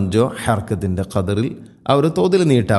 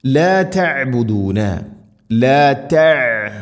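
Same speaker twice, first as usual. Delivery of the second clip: slow, drawn-out tajweed chant; Quranic recitation; 3 semitones higher, much slower than usual. Shown as a demonstration. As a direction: recite slowly, holding the long vowels. Quran teacher models the recitation.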